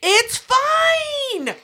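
high strained wailing cry; sarcastic outburst. Real, not acted. Friend exasperated over the ruined truck, wailing sarcasm. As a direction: wail it out in mock despair.

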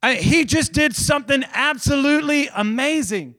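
high, emphatic wonder; preacher's voice lifted in excitement; amazed preaching. Real, not acted. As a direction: proclaim it as astonishing. Preacher marvels at the victory.

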